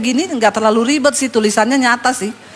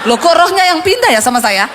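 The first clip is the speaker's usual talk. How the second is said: raised, puzzled retort, lightly acted; mock bewilderment; 4 semitones higher, 3 dB louder than usual. Woman startled that the pastor now talks rudely, bewildered. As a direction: imitate someone taken aback. Retold startled reply to "situ.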